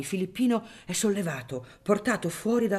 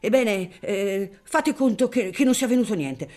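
agitated father; 6 semitones higher, 7 dB louder than usual. Acted. Father answers son in crowd.

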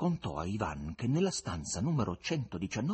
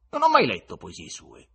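loud, edgy retort; nervy, forceful denial; irritated exclamation; 3 semitones higher, 10 dB louder than usual. A narrator's reading. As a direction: burst out, clearly annoyed. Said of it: "esclamò innervosito il visitatore".

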